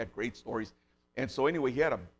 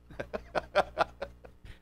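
real laugh; 6 semitones higher than usual. Real, not acted. Laughs at punchline.

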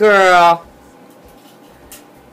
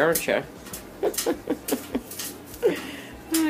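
ordinary delivery

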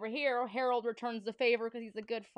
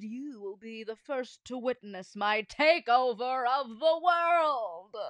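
villain voice; 2 semitones higher, 6 dB louder, slower than usual. Acted. Villain announcing world takeover.

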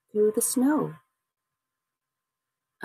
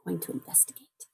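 soft, quick whisper; whispered narration; 2 semitones lower, 10 dB quieter, faster than usual. A narrator's reading. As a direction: whisper it like sneaking off to look. Creeping off to find the cry.

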